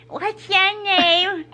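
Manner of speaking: drawn-out creepy come-on; creepy guy imitation. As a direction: mimic the creep, dragging every word. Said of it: "This dude sounded creepy".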